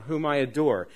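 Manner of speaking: ordinary talk, nothing marked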